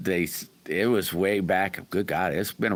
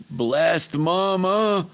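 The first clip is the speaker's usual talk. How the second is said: drawn-out greeting; 11 semitones higher, 5 dB louder, much slower than usual. Real, not acted. Greeting a viewer who joined chat.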